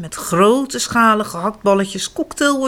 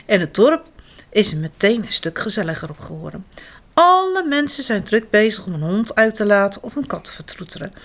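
ordinary delivery

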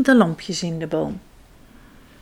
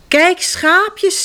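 put-on excited child; 10 semitones higher, 4 dB louder than usual. Kas finds sheep excitedly.

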